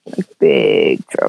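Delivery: mock-grave low warning; ominous low repeat. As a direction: drop the voice deep and slow for doom.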